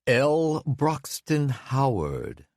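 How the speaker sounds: slow, high, posh British mock; sending up a British accent. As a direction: drawl the name in a fake British accent. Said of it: "using a mocking British accent".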